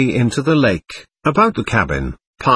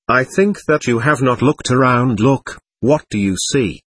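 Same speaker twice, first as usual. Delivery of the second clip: ordinary delivery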